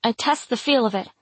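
ordinary delivery, nothing marked

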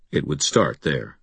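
ordinary delivery, nothing marked

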